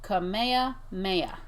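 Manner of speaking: slow, careful Kamehameha for others to repeat; say-along word. Shown as a demonstration. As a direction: sound out Kamehameha in two measured halves. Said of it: "You guys say these words".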